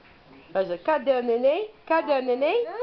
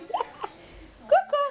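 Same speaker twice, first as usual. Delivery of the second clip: peekaboo squeal. Acted. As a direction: squeal it up high for the baby. Peekaboo game with baby.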